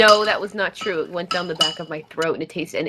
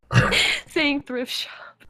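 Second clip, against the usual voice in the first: laughing; 10 semitones higher, 4 dB quieter, much slower than usual. Real, not acted.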